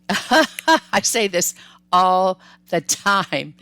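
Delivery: laughing through it, slow and loud; amused at her own habit. Real, not acted. Repeating her catchphrase to viewers while laughing.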